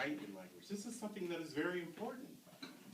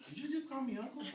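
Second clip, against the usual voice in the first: mock indignation; 11 semitones higher, faster than usual. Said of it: "and they're like".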